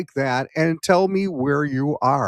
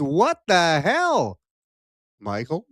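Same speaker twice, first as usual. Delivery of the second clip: mock outraged guest. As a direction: voice the indignant guest, slowly. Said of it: "and she says".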